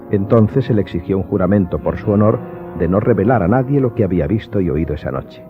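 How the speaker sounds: ordinary talk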